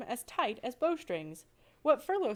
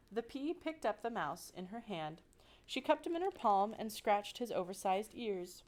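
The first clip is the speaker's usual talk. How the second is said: ordinary delivery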